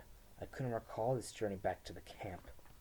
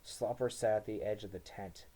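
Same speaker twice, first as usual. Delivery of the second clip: plain reading voice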